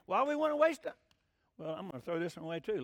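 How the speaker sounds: usual speaking voice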